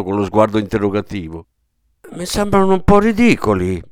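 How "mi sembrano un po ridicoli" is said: higher, whiny complaint; a mild whine, higher; complaining. A narrator's reading. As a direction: grumble it like a put-upon old man. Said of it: "si lagnò".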